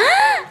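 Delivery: sudden very high short shriek; real startled high yelp. Real, not acted. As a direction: yelp as something jumps out. Startled by the dog.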